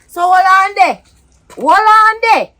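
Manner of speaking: high, loud, rising outburst; outraged disbelief. Acted. Partner grasping the betrayal, loudly incredulous. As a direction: halt them with mounting loud disbelief. Stunned, demanding a halt.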